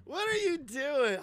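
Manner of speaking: high, loud, slow incredulous yell; shocked shout. Real, not acted. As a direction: cry out in disbelief. Shocked at the clip.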